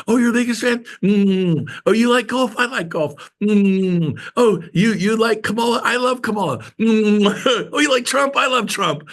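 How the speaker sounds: high, sing-song mirroring of every client taste; fake eager agreement. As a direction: gush along with whatever they like. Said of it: "so you just are like".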